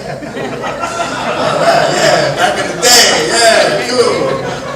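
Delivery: dismissive, laughing, drawn out; sarcastic brush-off. Retold or imitated. Strip searched by guards who know him, laughing brush-off. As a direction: play along with a smirk.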